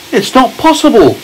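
put-on a child's curious question as James; James asking. A narrator's reading. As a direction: lift the voice up for the boy. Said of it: "said James".

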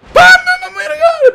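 very high squeal of surprise; squealed amazement. Real, not acted. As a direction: squeal it in disbelief. Amazed at the bot.

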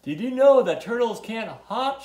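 high, loud storybook shout; story character shouting a question; excited shout. A narrator's reading. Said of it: "shouted Tudley".

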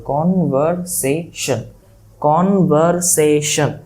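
কনভারসেশন very slow, four drawn-out beats each time; syllable-by-syllable demonstration. Shown as a demonstration. Teacher letting students hear four syllables, কনভারসেশন slowly split. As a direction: stretch কন-ভার-সে-শন, syllable after syllable. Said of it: "তুমি উচ্চারণ করে বুঝতে পারো".

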